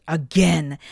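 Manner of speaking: loud roared exclamation; angry roar. A narrator's reading. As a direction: roar the word out in fury. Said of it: "Morg roared".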